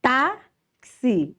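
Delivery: Táxi broken slowly, high long TÁ, pause; teacher's syllable-by-syllable Táxi; stress on TÁ. Shown as a demonstration. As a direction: split Táxi, holding the TÁ. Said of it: "o tá é a sílaba mais forte".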